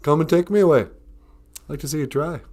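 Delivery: imitated stubborn defiance; defiant refusal, arms crossed. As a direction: dig in like a sulking leader refusing to leave. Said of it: "with his arms crossed going".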